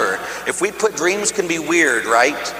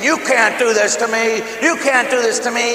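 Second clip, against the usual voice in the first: high, panicked plea; a touch of retold terror; mild retold scream; 7 semitones higher than usual. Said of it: "And I'm screaming".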